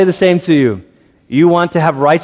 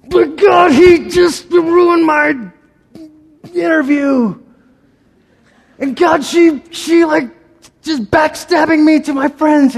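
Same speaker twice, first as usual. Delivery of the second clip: whining complaint; 13 semitones higher, slower than usual. Retold or imitated. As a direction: throw a tantrum at God about unfairness. Believer complaining to God.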